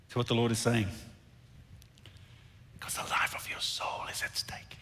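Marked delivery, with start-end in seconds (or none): 2.8-4.8 hushed, voiceless warning; solemn whisper. Real, not acted. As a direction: whisper it to the congregation with weight. Preacher drops to solemn whisper.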